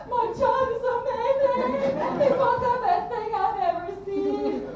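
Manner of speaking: hammed-up squeal of parental pride; gushing parent cheer. Parents applauding at a kid's show, gushing high-pitched imitation.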